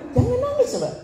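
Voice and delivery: high laughing plea not to cry; playful high-pitched comfort; mock comforting. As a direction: tease them gently not to cry, squeaky.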